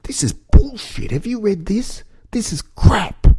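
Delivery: high put-on voice, soft, scoffing; mock commuter complaining. Host imitates a commuter reading Murdoch papers, high scoffing voice. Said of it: "read the, the morning Murdoch newspapers and go".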